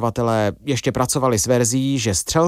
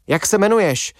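higher-pitched, restrained shouted question; mild shout, pitch lifted sharply; narrated shout; 8 semitones higher than usual. A narrator's reading. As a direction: call out the demand without full volume. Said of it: "křičeli na dezorientovaného muže".

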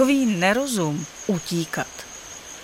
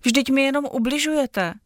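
blurted, higher and urgent; 7 semitones higher than usual. Acted. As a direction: burst out with it. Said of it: "vyhrkla Karolína". (vyhrknuté, vyšším hlasem)

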